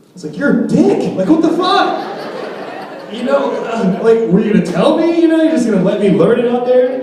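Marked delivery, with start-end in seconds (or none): 0.4-5.0 high mock outrage at dad; indignant yell. Son yelling at his dad, mock outraged. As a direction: yell at him, half laughing.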